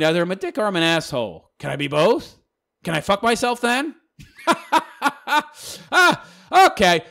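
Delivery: ordinary talk, nothing marked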